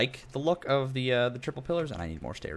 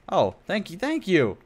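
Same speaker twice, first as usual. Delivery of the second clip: excited thanks; 8 semitones higher, 5 dB louder, slower than usual. Real, not acted.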